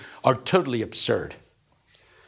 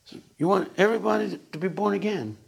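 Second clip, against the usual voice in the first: higher, lightly voiced bumbling questioner; a hint of a naive sharecropper; gently mock puzzled question; John Perkins impression; 6 semitones higher than usual. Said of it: "he talks like this".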